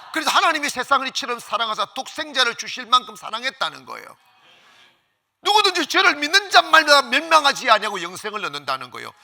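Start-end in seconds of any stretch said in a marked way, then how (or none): 5.4-9.1 loud, high, driving pulpit voice; ringing, insistent sermon climax; full-force preaching. Real, not acted. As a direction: proclaim it to the whole congregation.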